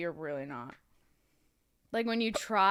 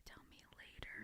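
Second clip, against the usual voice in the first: pleading whisper; 11 semitones lower, 24 dB quieter than usual. Real, not acted. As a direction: whisper it close to the mic. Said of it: "You're whispering right into the mic".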